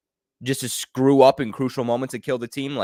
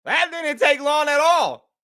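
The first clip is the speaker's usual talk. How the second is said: exasperated; 12 semitones higher than usual. Real, not acted. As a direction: groan at how fast it fell apart. Reacting to the interception.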